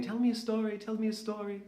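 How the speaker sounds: high, pleading voice; Aineen's high coaxing plea; imitating Aineen. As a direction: beg for a story like an eager girl. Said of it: "she was always saying".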